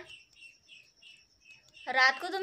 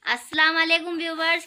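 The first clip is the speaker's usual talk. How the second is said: louder, projected greeting; 3 semitones higher, 7 dB louder than usual. Shown as a demonstration. Asked to speak up, greets viewers loudly. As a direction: project it so the viewers hear clearly. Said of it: "थोड़ा जोर से बोलो ताकि उनको आवाज़ clear आए".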